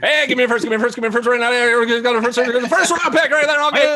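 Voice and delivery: rapid, high auctioneer chant. Pretend. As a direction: rattle off bids like an auctioneer. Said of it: "You should be doing it like an auctioneer voice right now".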